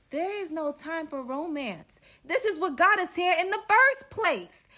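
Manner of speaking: scolding. Retold or imitated. Scolding the staring robber.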